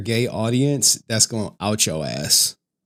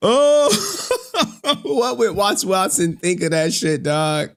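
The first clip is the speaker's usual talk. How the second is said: words broken by real laughter, much higher; giggling, pitched up high; laughing; 8 semitones higher, slower than usual. Real, not acted. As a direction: laugh through the line. Cracking up at his own joke.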